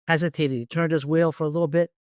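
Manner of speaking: ordinary talk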